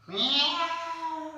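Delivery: a very high, long meow; cat meow. Shown as a demonstration. Yoga teacher voicing the cat in cat pose, high meow. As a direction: miaow like a stretching cat. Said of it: "the cat is rounding its back".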